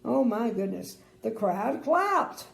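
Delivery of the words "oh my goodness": plain reading voice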